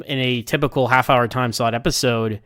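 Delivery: usual speaking voice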